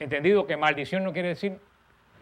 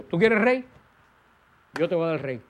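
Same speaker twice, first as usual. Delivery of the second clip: challenging God; 5 dB louder than usual. Acted. God challenges Israel demanding king.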